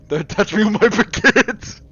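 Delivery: high, loud mock French outrage; crazy French accent. Streamer joking about the baguette line, crazy French accent. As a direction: yelp it in a silly French accent. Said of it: "and the crazy accent".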